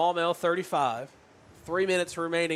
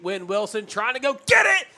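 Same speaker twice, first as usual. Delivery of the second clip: loud rising play-by-play; excited touchdown call; 9 semitones higher, 11 dB louder than usual. Real, not acted.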